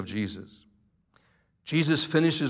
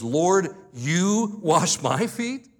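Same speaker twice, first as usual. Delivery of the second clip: incredulous; 5 semitones higher, 4 dB louder, much slower than usual. Retold or imitated. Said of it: "The literal Greek reading is".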